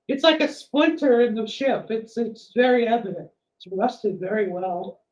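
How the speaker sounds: a flustered, eager-to-please character; nervous NPC voice. Acted.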